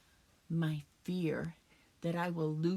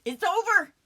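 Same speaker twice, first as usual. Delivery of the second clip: high, loud cry of despair; despairing outcry; 12 semitones higher, 8 dB louder than usual. Acted. Widow who sees no future, despairing outcry. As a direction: cry it out as all is lost.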